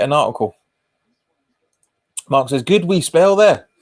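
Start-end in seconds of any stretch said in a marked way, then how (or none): 2.6-3.7 put-on a louder, slow slip into a Scottish accent; drawn-out Scottish accent, slightly raised; accidental Scottish. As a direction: read the comment out with a Scottish lilt. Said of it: "that came out a little bit Scottish".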